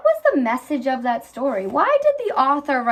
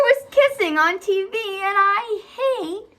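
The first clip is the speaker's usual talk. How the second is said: disgusted; 9 semitones higher than usual. A narrator's reading. Child's disgust in story.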